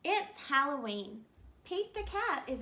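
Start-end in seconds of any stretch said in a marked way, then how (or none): none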